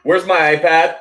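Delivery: loud, high call to another room; yelling across the room. Real, not acted. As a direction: shout for Dad from afar. Calling dad in another room.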